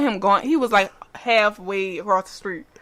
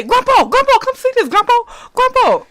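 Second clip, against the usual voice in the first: mock frightened calls; 12 semitones higher, 3 dB louder than usual. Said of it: "He said".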